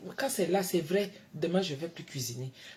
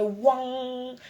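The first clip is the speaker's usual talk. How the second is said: slow, drawn-out, higher "ouang" noise; imitated ear sound; 7 semitones higher, 5 dB louder than usual. Shown as a demonstration. Describing her ear pain to viewers, a slow imitated ring. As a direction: stretch the noise out like a ringing in the ear. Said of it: "ça pénètre à l'intérieur".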